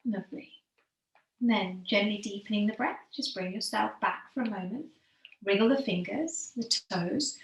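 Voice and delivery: calm yoga teacher easing the class into stillness; guided meditation. Acted. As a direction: guide them gently into the breath, letting phrases sink at the end. Leads breathing meditation.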